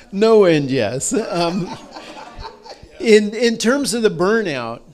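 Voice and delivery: laughing while answering. Real, not acted. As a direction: chuckle through the words. Laughs at money question.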